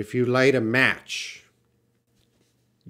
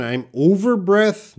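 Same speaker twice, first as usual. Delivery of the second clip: student's wrong phrase; 8 semitones higher, 5 dB louder than usual. Shown as a demonstration. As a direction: read out the wrong "I'm over breath" higher and louder, as a quoted error. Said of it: "No, no".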